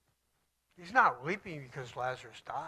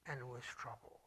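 whispered; 5 semitones lower, 15 dB quieter than usual. A narrator's reading. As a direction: whisper the words out, falling away. Narrating Jesus's sorrow.

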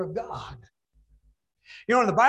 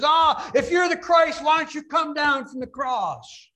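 loud, high jeering taunt; a thief's loud scornful heckle; mocking taunt; 9 semitones higher, 6 dB louder than usual. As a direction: jeer at him from the cross. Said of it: "taunting Jesus Christ and mocking him".

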